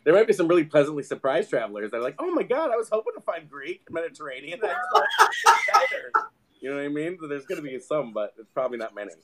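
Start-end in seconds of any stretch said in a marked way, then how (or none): 2.2-6.1 high, giddy, laughing; mock delighted traveler. Traveler finding falafel in a diner, hammed-up delight. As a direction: gush with amused surprise.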